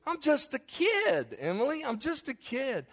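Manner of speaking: hammed-up childish protest. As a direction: imitate an exasperated little boy pleading. Acts out child protesting to Emily.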